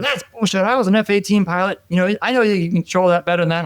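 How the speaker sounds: high-pitched imitation of an irate general; mad general. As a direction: play the superior calling out nonsense. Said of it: "and he's mad".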